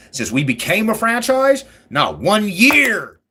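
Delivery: high, loud, very slow punch on not one year; emphatic boast. Real, not acted. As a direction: drag out not one year to hammer it home. Boasting about team consistency.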